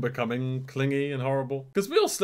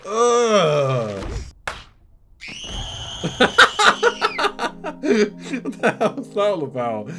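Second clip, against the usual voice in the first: laughing; 9 semitones higher, 6 dB louder, much slower than usual. Real, not acted. Laughing fit after a shock.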